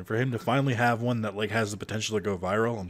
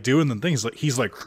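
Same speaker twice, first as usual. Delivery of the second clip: usual speaking voice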